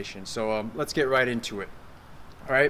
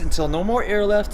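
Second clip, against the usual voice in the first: out of air; 6 semitones higher, 5 dB louder than usual. Shown as a demonstration. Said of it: "zip up your abs by making a z".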